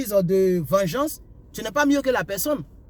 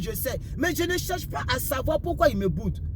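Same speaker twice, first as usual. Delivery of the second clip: normal talking voice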